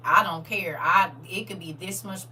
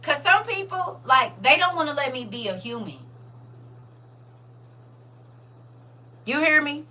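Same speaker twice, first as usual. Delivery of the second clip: much higher, loud heartfelt burst; excited thanks; 9 semitones higher, 9 dB louder than usual. Real, not acted. Thanking viewers for letting her be human, loud and high. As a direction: cry out thanks with full feeling.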